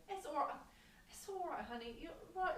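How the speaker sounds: put-on high British-accented comforting; imitated British lady. British lady consoling weeping American, high imitated accent. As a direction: soothe briskly as an imitated British stranger. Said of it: "this reserved British woman".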